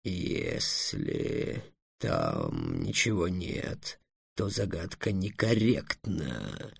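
low, very slow, soft dragon voice; ponderous dragon ruling; objecting dragon. A narrator's reading. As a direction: drag out the objection quietly.

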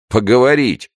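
loud, high, derisive exclamation; scornful snort. A narrator's reading. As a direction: throw the word back with contempt. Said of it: "фыркнул незнакомец". (презрительно фыркая)

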